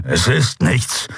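slow, mild growl; downplay the wound through clenched teeth; wounded Kero downplaying his injury with a growl. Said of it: "knurrte er".

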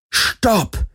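high sharp shout; shouting stop. Acted. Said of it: "schrie Garlin".

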